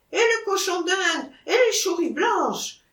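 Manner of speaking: put-on very high Auvergnat accent, insistent; Auvergnat protest. Seller insists on the rest of the price, Auvergnat accent.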